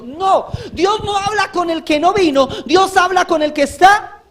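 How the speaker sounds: más fuerte y alto, clamando; predicación enérgica. Real, not acted. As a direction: proclama con fuerza a la congregación. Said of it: "predicador eleva la voz". (predicación a voz en cuello)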